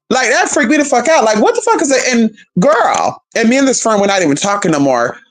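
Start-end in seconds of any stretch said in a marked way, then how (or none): none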